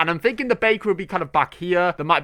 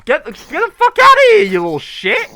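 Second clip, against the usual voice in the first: much higher and louder furious yell; angry yell; 9 dB louder than usual. Real, not acted. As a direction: scream the mob away. Yelling at mob in game.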